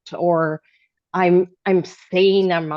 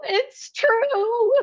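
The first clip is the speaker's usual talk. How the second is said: tearful. Real, not acted. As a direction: voice breaking with real tears, pitched high. Emotional confession to the group.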